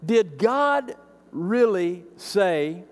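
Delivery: very slow, drawn-out tempting question; insinuating snake voice; sly serpent tone. Retold or imitated. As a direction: let the doubt creep in. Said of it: "notice the tone here".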